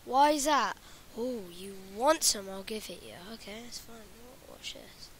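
normal talking voice

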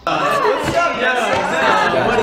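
high, bright intro shout; vlog greeting. Real, not acted. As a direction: greet the viewers big and high.

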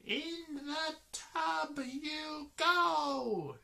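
very high, loud, drawn-out shriek; screamed order. A narrator's reading. Missus Wishy-Washy orders the animals into the tub, shrieking. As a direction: shout the order slowly at top pitch. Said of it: "she screams".

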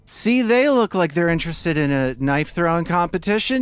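high, loud hustler's pitch; drunken hustler. Acted. Drunk Carmen selling a knife contest, high and pushy. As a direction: pitch the bet loudly, voice climbing. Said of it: "he says".

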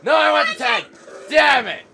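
excited gamer yelling; shouting. Real, not acted. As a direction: yell it out in frustration. Frantic shouting during a firefight.

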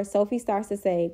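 plain reading voice

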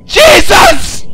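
piercing scare scream; terrified shriek. Real, not acted. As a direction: scream the word in fright. Jump scare in game.

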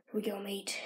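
voiceless hush; whisper. Real, not acted. Trick shot in the bathroom, whisper. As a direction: breathe it out.

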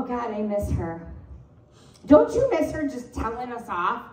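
ordinary talk

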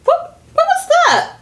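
startled. Acted. Startled by a noise.

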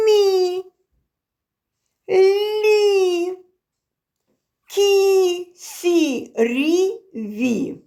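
"Мі, лі, кі, сі, рі, ві" with palatalized consonants and drawn-out "і"; soft consonants. Shown as a demonstration. Teacher showing soft consonants before І, each palatalized. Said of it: "М'які звуки, що стоять перед І".

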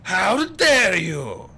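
high, drawn-out indignant shout; loud, slow villain's outburst; outraged shout. A narrator's reading. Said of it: "Stefano shouted".

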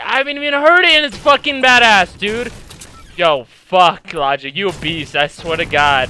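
ordinary talk, nothing marked

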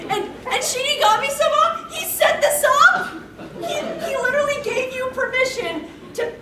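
incredulous and laughing; excited disbelief. Real, not acted. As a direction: splutter it in disbelief. Disbelief at setup.

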